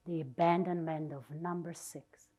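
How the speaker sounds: ordinary talk, nothing marked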